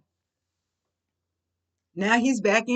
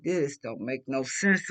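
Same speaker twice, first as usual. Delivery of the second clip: lower, slow, fed-up aside; low exasperated grumble; 9 semitones lower, 5 dB quieter than usual. Retold or imitated. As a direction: say it under your breath, disgusted. Exasperated at the idiot.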